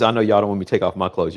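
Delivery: ordinary talk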